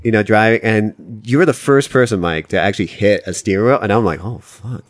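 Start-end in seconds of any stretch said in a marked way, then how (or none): 4.2-4.8 much softer muttered curse; scared under breath. Retold or imitated. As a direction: barely voice it, startled. Scared in car as Mike hits wheel.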